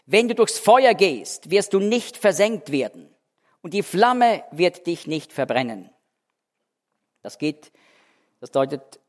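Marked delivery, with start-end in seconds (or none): 0.1-5.9 fervent scripture reading. Acted. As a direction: read it like a promise under fire. Reading Isaiah with fervour.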